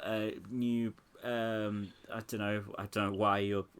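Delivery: normal talking voice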